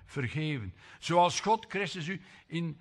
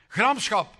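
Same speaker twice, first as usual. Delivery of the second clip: loud, higher proclamation; proclaimed; 5 semitones higher, 8 dB louder than usual. Shown as a demonstration. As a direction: call the sin out loud. Loud sin word.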